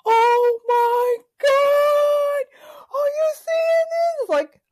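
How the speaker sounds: shocked bystander. Retold or imitated. Bystander filming the killing for friends, hammed-up shock. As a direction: play a gawking crowd member live streaming. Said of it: "now the crowd is like".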